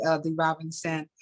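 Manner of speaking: plain reading voice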